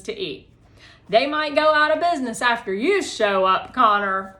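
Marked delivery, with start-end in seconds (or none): 1.1-4.4 teasing. Acted. Teacher teasing hungry Connor.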